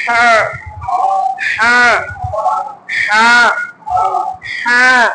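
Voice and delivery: each "হ্যাঁ" a separate ha pressed from mid-throat. Shown as a demonstration. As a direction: drill "হ্যাঁ" as a throaty ha, one at a time. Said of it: "মাঝখানে চাপ দিবেন".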